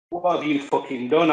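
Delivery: ordinary talk, nothing marked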